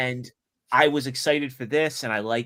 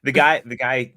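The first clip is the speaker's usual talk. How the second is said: normal talking voice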